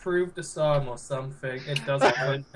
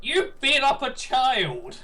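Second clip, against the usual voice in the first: higher, slow exclamation of outrage; outraged yell; 7 semitones higher, slower than usual. Acted. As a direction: draw it out in mock outrage. Acted outrage at child beating.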